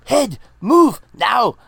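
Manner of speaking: loud, high Scottish yell, drawn out; Scottish accent. Retold or imitated. Imitating the Scottish father from So I Married an Axe Murderer, over-the-top Scottish accent. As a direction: bellow it slowly in a hammy movie Scottish accent. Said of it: "that sounded like Schwarzenegger at the end".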